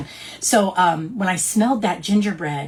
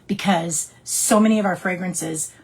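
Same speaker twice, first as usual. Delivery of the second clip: ordinary talk, nothing marked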